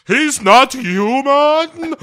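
loud, high, slow mock cry; hammy shout. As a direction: belt it like a movie quote. Quoting Rocky movie line.

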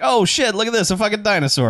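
high and fast, thrilled; giddy mock amazement; excited discovery. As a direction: blurt out the find in a rush. Retold character spotting dinosaur.

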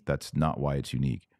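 ordinary talk, nothing marked

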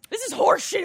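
high, loud indignation; indignant outburst. Real, not acted. Host objects to the can's label, indignant outburst. Mock outrage at the can's name.

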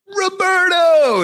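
high, drawn-out cheer; crew calling out. Retold or imitated. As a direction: imitate a crew hailing their pal. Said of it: "Meanwhile, the crew's like".

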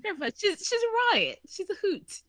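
high, playful British put-on; British accent. Podcaster playing Charles praising Camilla, hammed-up British accent. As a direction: gush in a high-pitched mock British accent. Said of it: "get to do my British accent".

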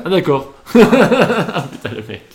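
bursting out laughing; high, loud laugh through the words. Real, not acted. Laughs at friend's mistake.

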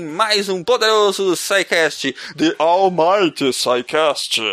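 slow, grand announcer sign-off. Acted. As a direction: boom it out like a trailer.

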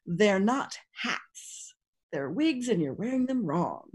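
a slow, quiet, knowing correction; slow deliberate correction. Retold or imitated. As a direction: lay it out slowly like a pointed put-down. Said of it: "tells these ladies".

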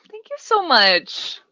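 high, slow, put-on hurt thanks; mock offended. Answering the co-host's nose joke, mock offended. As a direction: drag out a wounded thank you.

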